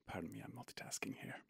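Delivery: plain reading voice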